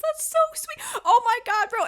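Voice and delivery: giddy fan squeal; squealing gush. Real, not acted. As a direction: burst out gushing. Gushing over a sweet anime scene.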